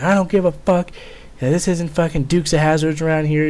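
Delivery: mock a master sergeant freaking out; imitated furious sergeant. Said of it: "he was just furious".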